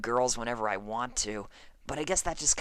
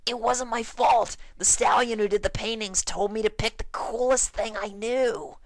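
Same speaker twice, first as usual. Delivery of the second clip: high, loud defensive protest; panicked pleading; 8 semitones higher, 4 dB louder than usual. Acted. Dash shielding her face from Applejack, high loud panicked plea. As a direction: plead your innocence in a hurry.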